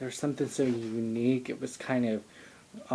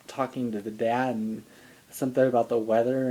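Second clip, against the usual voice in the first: usual speaking voice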